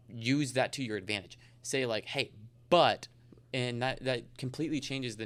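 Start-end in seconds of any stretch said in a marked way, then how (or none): 2.1-3.1 "but" punched louder as a hook; emphasis on BUT. Shown as a demonstration. Salesman grabbing a client's attention, emphasis on "but". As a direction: stress "but" hard to grab attention. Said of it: "throw that one little emphasis word".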